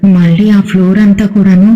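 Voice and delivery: usual speaking voice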